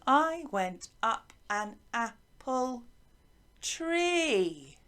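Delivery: slow chant, long final TREE; sing-song rhythm landing long on "tree"; stress on tree. Shown as a demonstration. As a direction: stretch the last word "tree". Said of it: "What word would it be on?".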